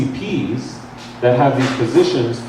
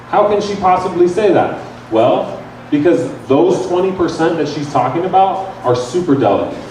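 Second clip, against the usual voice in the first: ordinary delivery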